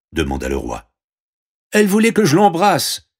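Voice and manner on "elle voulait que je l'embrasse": high, loud protest; exclaiming princess. Acted. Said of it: "s'exclama la princesse". (exclamation indignée et aiguë)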